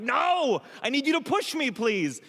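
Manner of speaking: high, desperate begging; panicked pleading. Retold or imitated. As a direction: beg her in a panic, voice pushed way up. Pleading with ex on Skype.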